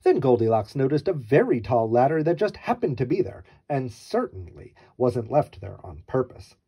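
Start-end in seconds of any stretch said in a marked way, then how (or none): none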